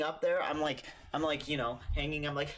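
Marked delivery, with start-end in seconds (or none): none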